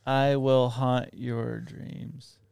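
slow, soft spooky threat; haunt them slowly; mock ghost. Playing the computer ghost, slow soft spooky threat.

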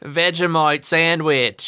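slow, broad mock Aussie; Australian accent. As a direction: stretch out the Australian vowels. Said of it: "Can you guys do it?".

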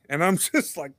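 ordinary delivery

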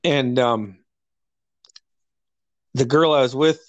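ordinary talk, nothing marked

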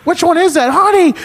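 very high, loud shriek of delight; thrilled old lady. Retold or imitated. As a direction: imitate old lady calling out to her husband. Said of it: "this old lady answered the phone".

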